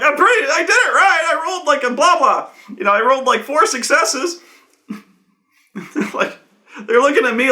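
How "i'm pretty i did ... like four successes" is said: high, flustered defence; acted indignant protest. Game master as the player defending his roll, acted indignant protest. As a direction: insist on it in imitated frustration.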